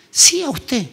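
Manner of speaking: a light mock voice for the woman; preacher voicing the kind sister's reply; imitating the church sister. Quoting the sister's invitation.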